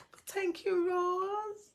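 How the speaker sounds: high squeaky voice, nearly crying, thanking a viewer; choked-up, high and overwhelmed by the gift; touched near tears. Real, not acted. Host thanking viewer Rose for stars, high teary squeak. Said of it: "you make me cry".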